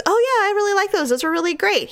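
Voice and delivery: quick, high, eager mock husband; imitating the husband's eager gushing. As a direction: gush about the shoes like him. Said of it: "And he goes".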